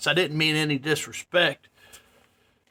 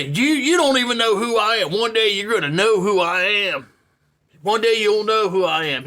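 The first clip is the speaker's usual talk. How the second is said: higher-pitched imitation of a man starting on someone; retold angry tirade; 5 semitones higher, 3 dB louder than usual. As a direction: rant it as the offended stranger. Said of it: "then he starts on me".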